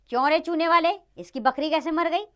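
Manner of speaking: high, loud royal demand; accusing interrogation. Acted. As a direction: call the accused to account. King interrogates lime seller.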